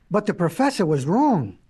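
ordinary delivery, nothing marked

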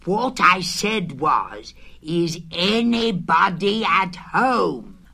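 slow, loud, emphatic repeat; very loud call. A narrator's reading. Pooh repeating himself into the hole, very loud calling out. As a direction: spell it out loudly and slowly. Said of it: "called out Pooh very loudly".

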